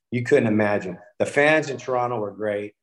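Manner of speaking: usual speaking voice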